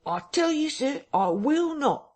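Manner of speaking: very high, indignant refusal; voice rising. A narrator's reading. Vicar refusing someone inside the parlour, voice rising indignantly. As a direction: refuse indignantly, pitch right up. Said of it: "his voice rising".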